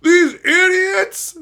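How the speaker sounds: high, loud, slow outraged cry; mock outraged yell. Streamer showing the angry rant he didn't do, mock yell. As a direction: yell like a furious ranter, dragging it out. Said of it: "I'm not even yelling, going".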